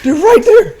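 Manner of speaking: high, drawn-out exasperated mimicry; loud, high-pitched frustrated yell through a laugh; pissed. Gunner pointing out enemies to his brother, pissed. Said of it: "Oh, he was pissed".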